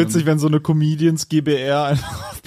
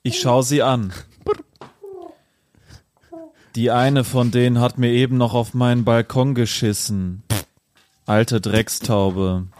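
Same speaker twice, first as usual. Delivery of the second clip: poem recital; 2 semitones lower than usual. Acted. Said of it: "das Abschlussgedicht, äh, vortragen".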